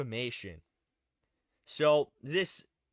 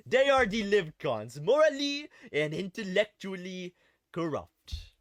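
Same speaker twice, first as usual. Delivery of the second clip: emphatic mock French-Canadian accent; Maxime Bernier accent; 2 semitones higher than usual. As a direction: ham up the French-Canadian accent as the claim gets repeated. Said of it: "I gotta say it in his accent".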